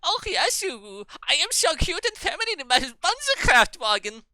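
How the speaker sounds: put-on very high, loud German accent; squeaky mock-feminine German voice; cute German e-boy. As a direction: play a cutesy, hammed-up German boy. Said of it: "the sound of a Germany boy".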